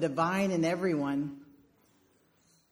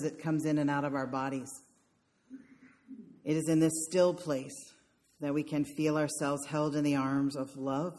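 guided meditation; 3 semitones lower, 4 dB quieter than usual. Acted. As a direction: ease them into stillness. Leads a meditation.

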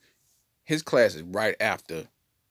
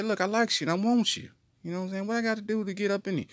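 high mock teacher; 9 semitones higher, faster than usual. Teacher voiced propositioning boy.

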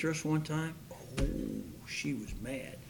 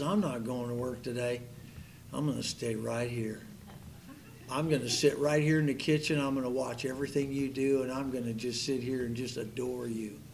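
softer, lower lovesick husband; lightly voiced husband swearing to stay home all day; doting husband; 4 semitones lower, 6 dB quieter than usual. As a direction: murmur it like a smitten groom. Husband refuses to leave her.

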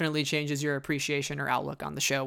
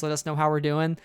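ordinary delivery

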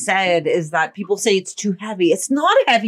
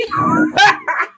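high laugh. Real, not acted. Laughing at messy night.

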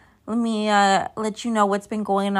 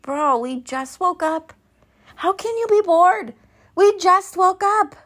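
higher and louder, fed-up disbelief; exasperated retort; 9 semitones higher, 3 dB louder than usual. Retold or imitated. Mom to bored kids after waking, exasperated and loud. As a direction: snap back at the kids in disbelief. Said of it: "I'm like".

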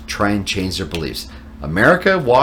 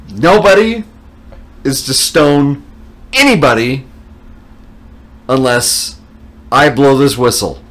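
raised, loud official proclamation in measured phrases; high priest commanding; 5 semitones higher, 5 dB louder than usual. Retold or imitated. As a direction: lay down the law to an unruly crowd. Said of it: "And he goes".

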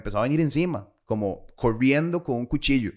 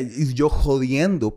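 normal talking voice

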